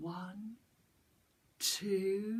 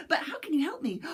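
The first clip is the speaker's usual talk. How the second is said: high, squeaky, fast panicked little voice; a tiny cookie in a flap; high panicky little gingerbread man; frightened gingerbread man; 10 semitones higher, 9 dB louder, much faster than usual. Acted.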